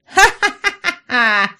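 high, drawn-out acted cackle; villainess's cackle. A narrator's reading. Раяна greets her approaching enemies, cackling. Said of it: "Раяна расхохоталась".

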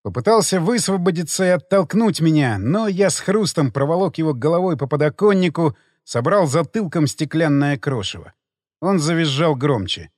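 ordinary delivery, nothing marked

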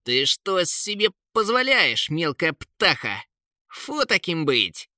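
high-pitched, outraged king; indignant bark. A narrator's reading. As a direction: scold the bird in a high, indignant voice. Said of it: "рявкнул Кейн". (возмущённо, на повышенных тонах)

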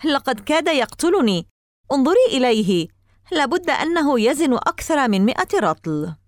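high-pitched, animated outcry; exclaiming. A narrator's reading. Boy telling mother the huge dog nearly killed him, exclaiming. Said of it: "هتفت قائلًا".

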